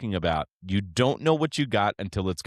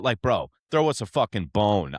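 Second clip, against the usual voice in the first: usual speaking voice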